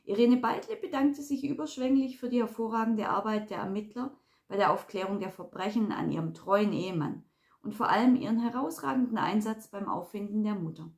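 usual speaking voice

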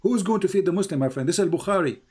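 ordinary delivery

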